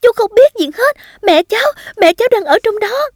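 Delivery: put-on high-pitched frantic child's protest; crying out. A narrator's reading. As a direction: plead at a high pitch with the firefighter. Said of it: "và la lên".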